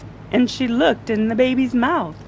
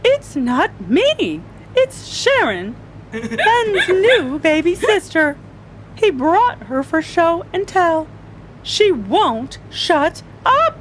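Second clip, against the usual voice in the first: high, slow, whiny protest; flustered teacher at wits' end; exasperated teacher; 8 semitones higher, slower than usual. A narrator's reading. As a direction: plead innocence, dragging out each word.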